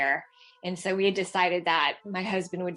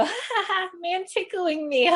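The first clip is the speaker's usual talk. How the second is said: put-on giggling toddler; 11 semitones higher than usual. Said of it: "He'd go, laughs".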